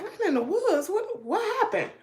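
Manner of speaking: high-pitched disbelieving echo; incredulous. Retold or imitated. Wife hears dog ran in the woods, incredulous high questioning. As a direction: voice shoots up, demanding an explanation. Retells disbelief at husband's news.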